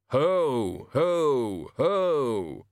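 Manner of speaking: separate, falling ho-ho-hos; practised Santa-style laugh. Pretend. Death rehearsing the Hogfather laugh, stilted. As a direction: boom each ho deliberately, like rehearsing.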